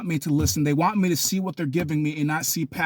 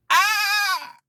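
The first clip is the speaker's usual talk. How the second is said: long, high wailing scream; yelling; 3 dB louder than usual. Acted. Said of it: "if you yell back".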